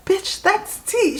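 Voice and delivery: very high, slow, incredulous; shocked insistence. Retold or imitated. Telling her friend who the tagged man is, shocked insistence. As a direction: stretch it out high in retold shock.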